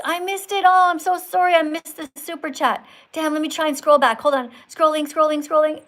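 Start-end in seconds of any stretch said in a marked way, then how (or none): 0.0-2.9 raised, louder flustered sorry; real dismay at missing it; flustered apology. Real, not acted. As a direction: scramble to apologise, voice jumping up. Missed a viewer's super chat.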